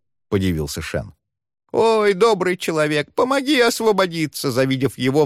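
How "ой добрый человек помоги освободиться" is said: high, begging crone voice; pleading old woman. A narrator's reading. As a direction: plead like a trapped old hag. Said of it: "взмолилась она".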